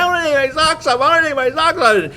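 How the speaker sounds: high shrieking mock tantrum; screaming child tantrum. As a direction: wail it like a raging little girl. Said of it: "Screaming and yelling".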